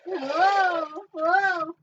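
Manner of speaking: big put-on wonder; hammed-up whoa. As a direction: play up the amazement, loud and high. Hammed-up reaction to show's title.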